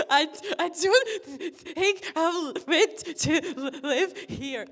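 high, loud, slow, broken sobbing words; impression of myself crying. Phoning mum, sobbing too hard to speak. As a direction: blubber it out like an inconsolable kid. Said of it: "I'm gonna do an impression of myself".

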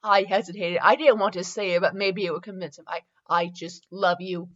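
measured, earnest read-aloud voice; story narration. Acted. Reading fan fiction aloud to listeners, story narration. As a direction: read the romance out evenly and sincerely.

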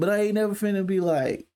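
ordinary talk

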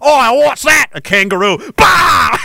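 high, excited Aussie exclamation; hammed-up Australian battle yell at the end; Australian accent. Settler charging at a kangaroo, loud Australian accent.

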